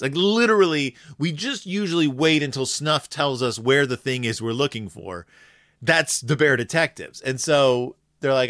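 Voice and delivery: ordinary talk, nothing marked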